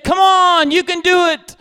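high, loud retold egging-on; pushy mob. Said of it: "ganged around me like an angry mob".

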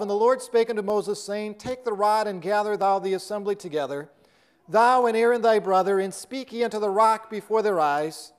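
measured, solemn King James reading; scripture reading. Acted. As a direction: read the verses like holy writ. Reads scripture aloud.

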